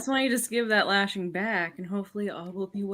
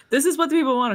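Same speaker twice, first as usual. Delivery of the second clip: loud playful declaration; 8 semitones higher, 12 dB louder, much faster than usual. Acted. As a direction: raise the voice, playful.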